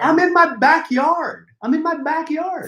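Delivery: high-pitched defensive protest; affronted, squeaky self-defence; mock indignation.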